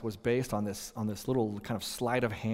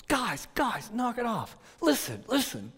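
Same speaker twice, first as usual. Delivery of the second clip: yelling coach; 9 semitones higher than usual. Retold or imitated. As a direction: rein the boys in like an exasperated coach. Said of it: "starts yelling at us".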